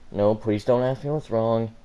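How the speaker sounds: ordinary delivery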